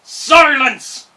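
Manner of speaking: loud giant roar. Acted. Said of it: "roared a great voice".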